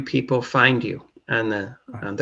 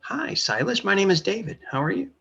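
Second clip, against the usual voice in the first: higher-pitched mock hello; friendly introduction; 7 semitones higher, 3 dB quieter than usual. Shown as a demonstration. Showing how networking starts, higher-pitched friendly greeting. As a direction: demonstrate a first hello, pitched up and easing down. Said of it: "networking is like this".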